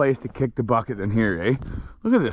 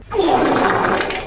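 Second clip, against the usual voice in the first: mock wail; 4 dB louder than usual. Wailing after being crop dusted.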